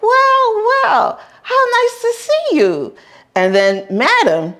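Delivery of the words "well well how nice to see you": high syrupy gangster; high, falsely sweet greeting; sweet-talking man. Retold or imitated. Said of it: "he was like".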